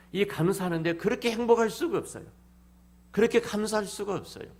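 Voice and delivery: high, loud, overflowing with gratitude; a preacher crying out his thanks; joyful exclamation. Real, not acted. Preacher exults over his gratitude.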